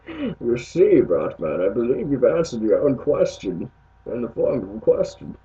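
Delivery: lightly voiced comic-book villain. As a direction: talk to Batman like his foe. Answers the Batman question in character.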